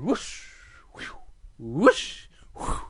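short airy swing noises; swinging whooshes. Acted. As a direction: imitate a swing swishing back and forth. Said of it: "and he goes".

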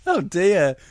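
ordinary delivery

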